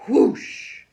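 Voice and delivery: loud airy whoosh; a bird rushing past the ear; whoosh sound. A narrator's reading. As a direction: blow the whoosh like wind. Sound effect of bird zooming.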